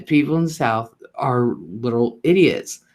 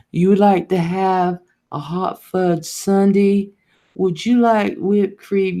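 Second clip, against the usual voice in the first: imitating a slow, drawn-out ice cream server, pitched higher; leisurely, higher drawl taking an order; slow Southern lady; 5 semitones higher, slower than usual. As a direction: stretch out every word like a slow-talking Southern lady. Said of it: "everybody talking so slow".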